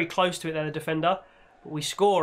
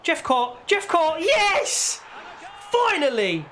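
building goal shout; 9 semitones higher, slower than usual. Real, not acted.

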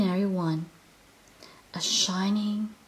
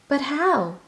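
anxious question; 6 semitones higher, 3 dB louder than usual. Acted. Sniff despairs of finding tree.

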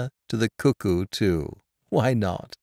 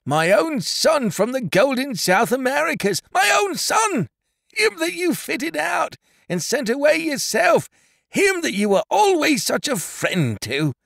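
high, triumphant, overjoyed cry; exultant; 13 semitones higher than usual. A narrator's reading. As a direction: proclaim it high, bursting with a father's pride. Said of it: "said Caleb exultingly".

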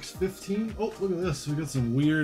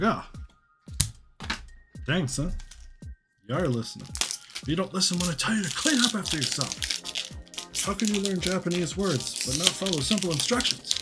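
higher-pitched, playful parental rant; mock-scolding dad; 6 semitones higher than usual. Dad jokingly scolding his younger son over listening, mock-exasperated. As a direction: pretend to tell off your kid, pitch climbing. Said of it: "Just kidding".

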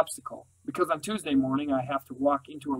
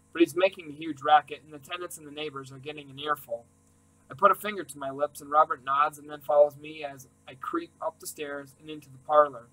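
ordinary delivery

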